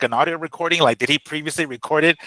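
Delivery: usual speaking voice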